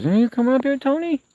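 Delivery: very high, loud pet-calling voice; coaxing animal call. Real, not acted. Man calling his raccoon to come up, high loud coaxing. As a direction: sing out high to summon a pet.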